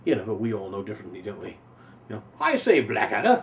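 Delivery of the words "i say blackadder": put-on posh English accent. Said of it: "the English voice".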